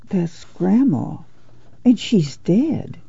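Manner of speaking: put-on hushed, slow child's whisper. A narrator's reading. Ruthie to Winfield beside Grandma's body, whispered. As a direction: whisper it slowly, as if scared to be overheard. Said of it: "And Ruthie whispered".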